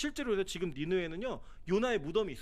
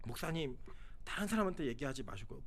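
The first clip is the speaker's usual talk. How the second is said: hushed request; 6 semitones lower, 8 dB quieter than usual. Retold or imitated. Congregant secretly asking pastor.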